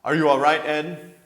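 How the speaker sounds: loud worried call into the dark; shouting a check on a friend. Acted. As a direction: call out to find Ed. Calling out in the dark.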